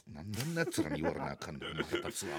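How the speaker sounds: low quiet irritated mutter; muttered grumble. Retold or imitated. Alone in the changing booth, quiet irritated mutter. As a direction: grumble to yourself behind the door. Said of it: "ちょっとだけ声出しました".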